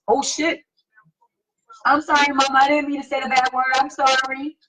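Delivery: hammed-up crying to get out of trouble; mock panicked apology. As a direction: grown child mock-begging mom not to punish.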